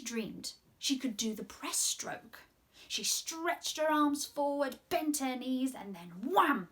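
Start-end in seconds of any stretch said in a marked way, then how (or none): none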